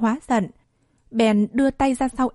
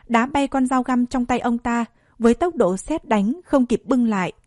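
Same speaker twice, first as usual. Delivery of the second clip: ordinary delivery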